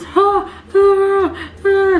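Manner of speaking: put-on high, loud sobbing gasps copying the child; high imitated sobbing gasps of a scared child; imitating child crying. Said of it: "Aaron was crying".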